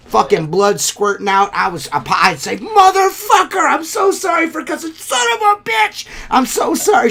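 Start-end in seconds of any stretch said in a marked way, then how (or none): 2.6-7.1 pained cussing. Retold or imitated. Injured window cleaner cussing before the customer, pained howling. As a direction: howl in pain, squeezing in frantic apologies. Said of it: "ran around this lady's house six times cussing".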